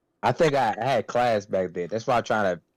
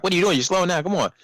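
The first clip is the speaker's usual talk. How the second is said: higher-pitched mimicked griping; mock complaining; 7 semitones higher, faster than usual. Complaining about a worker slowing down, higher mock griping. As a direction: lightly imitate an impatient complaint. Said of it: "She's just gonna complain like".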